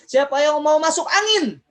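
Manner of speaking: high, loud crowd call. Real, not acted. Teacher springing a trick question on children, loud rallying shout. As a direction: belt it out as if rousing them for real. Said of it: "Lebih keras lagi".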